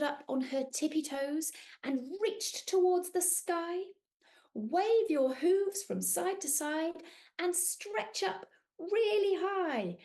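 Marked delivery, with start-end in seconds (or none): 4.5-10.0 sing-song unicorn voice. Acted. Unicorn Cleo calls to friends.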